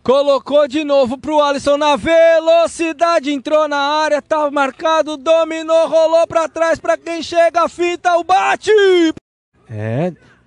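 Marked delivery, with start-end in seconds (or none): 0.0-8.7 from brisk call to a shout on the shot; building excitement. Real, not acted. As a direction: build the attack, then yell the shot.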